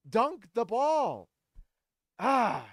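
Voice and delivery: high, slow, loud plea; exasperated yell. Real, not acted. Fan yelling at Kessler one last time, exasperated. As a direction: drag it out, pleading.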